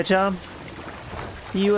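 usual speaking voice

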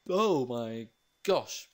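long drawn-out wonder; slow amazed. Real, not acted. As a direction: let it stretch out, amazed. Awed by the view.

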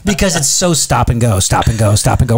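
ordinary delivery

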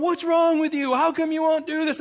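high, loud, exasperated mock rant; imitated angry scolding. Balaam scolding his donkey, high mock anger. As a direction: act out a man fuming at his animal. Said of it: "You ever been that angry?".

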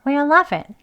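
"are" nearly dropped in Why are you laughing; reduced, quick. Shown as a demonstration. Teacher shows the native reduced form. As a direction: run it together with no r. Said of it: "라고 발음이 되어지는 겁니다".

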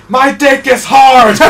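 imitating a screaming guy in the crowd; high, loud, slow yell breaking into laughter. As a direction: scream it like an overexcited spectator. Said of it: "one guy in the background screaming".

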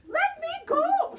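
high, loud shriek; leprechaun shriek. Acted. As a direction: squeal to be let go. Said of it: "shrieked the leprechaun".

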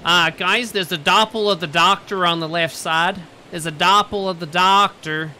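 bad-accent impersonation. Player posing as a crewmate to warn the guys, loud impression. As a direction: call out the warning in a forced imitation. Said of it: "my impression was flawless".

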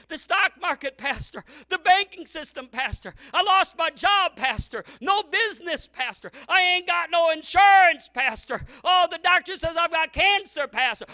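each fear called out high and loud, then pastor dropped; mock worried church member complaining to the pastor; anxious churchgoer. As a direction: list the worries to the pastor, pleading. Worried churchgoers listing fears.

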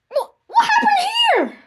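high wailing cry of alarm; panicked wail at a shocking sight; crying shout. Acted. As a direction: shriek it through tears. Jeffy finds something in bathroom.

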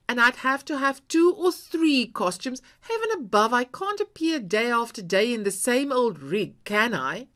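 loud, high exasperated tirade; angry outburst. A narrator's reading. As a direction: let it all pour out, furious. Wife exploding at husband.

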